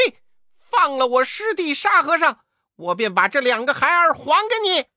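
high, loud monkey demand from a cliff; shouted demand. A narrator's reading. Wukong on the cliff shouting terms to the princess, loud and high. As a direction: call down the ultimatum.

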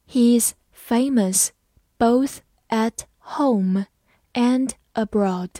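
slow, phrase by phrase; slow, chunked model reading. Shown as a demonstration. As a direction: read it slowly in chunks for the class. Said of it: "慢慢来读".

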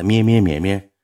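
humming the phone's ring tone, mock buzz; phone ring imitation. Telling the story, he hums the phone ringing.

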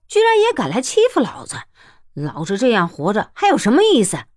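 cursing, shouting and spitting out the words; furious rant. Acted. Smuggler's furious rant at fate. (愤怒地破口大骂)

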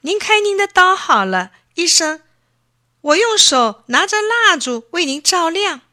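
put-on high, loud child's voice, urgent and earnest; fearful boy. Boy offering the doctor candlelight, high and frightened. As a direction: plead like a frightened little boy. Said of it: "爱迪生害怕他哭出声来".

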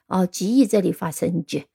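ordinary delivery

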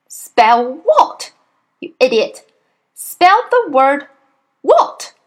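loud, high, spaced-out command; exasperated shout. A narrator's reading. Headmistress repeats her order to the idiot boy, exasperated. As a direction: hammer it out at a slow pupil, jumping up on WHAT.

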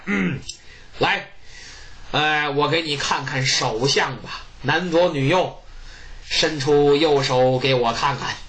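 put-on low, slow, deliberate old man; low unhurried fortune-teller voice. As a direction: drawl it out like a sly old diviner. Jiang Ziya reads palm.

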